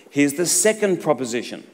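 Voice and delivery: ordinary delivery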